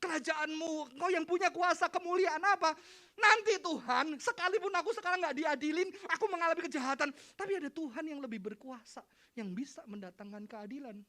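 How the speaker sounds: voicing the bullied. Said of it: "dibully itu ngomong apa".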